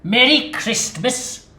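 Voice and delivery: very loud, sneering miser; mock him bitterly; angry Scrooge scolding; scornful rant. Scrooge mocks nephew.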